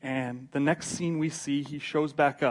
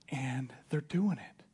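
slow, soft and half-whispered; hushed reveal; 3 semitones lower, 6 dB quieter, slower than usual. Acted. Timothy tells Paul the church is thriving, hushed. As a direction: breathe the news out quietly and slowly. Timothy acted, first quiet telling.

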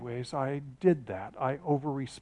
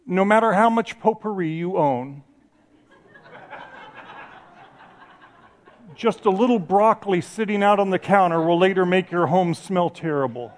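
mock translation, laughing; 4 semitones higher, 7 dB louder than usual. Shown as a demonstration. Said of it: "the exact way it's written in the Hebrew".

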